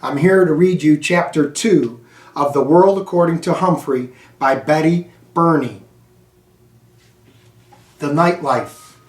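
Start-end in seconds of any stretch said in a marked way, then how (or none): none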